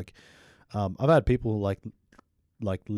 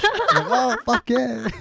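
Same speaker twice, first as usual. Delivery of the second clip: very high, laughing whoop; laughing cheer; 4 dB louder, much slower than usual. Retold or imitated. His laughing reply to mom.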